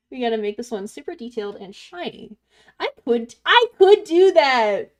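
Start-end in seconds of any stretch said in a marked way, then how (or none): 2.8-4.9 loud, slow put-on voice; character voice. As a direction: ham it up as a cartoon character. Mock character voice after being asked.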